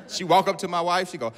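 ordinary talk

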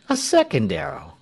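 high-pitched show of surprise; feigned astonishment. A narrator's reading. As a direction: act astonished, pitch jumping up then falling. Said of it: "pretended to be very much astonished".

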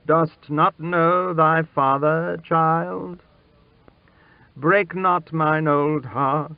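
high-pitched, imploring father; anxious, entreating royal voice; pleading king. A narrator's reading. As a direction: beg your child to know you.

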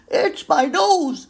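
frightened. Acted. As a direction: panic as the monster nears. Monster approaching, panic.

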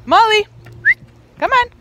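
high, loud call to the dog. Real, not acted. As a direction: call Molly over from afar. Calling the dog.

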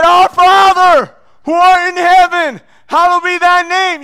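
very high, slow, loud desperate prayer; yelled prayer. Retold or imitated. Beaten man crying out the Lord's Prayer, loud. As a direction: cry the prayer out to God. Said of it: "So I yell".

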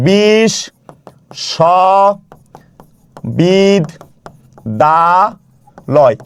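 বিশ্ববিদ্যালয় pulled far apart, each syllable held, long silence between; very slow, widely spaced syllables of বিশ্ববিদ্যালয়; broken into syllables. Shown as a demonstration. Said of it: "ভেঙে ভেঙে উচ্চারণ করি". (বিশ্ববিদ্যালয় ভেঙে ভেঙে, লম্বা বিরতি দিয়ে)

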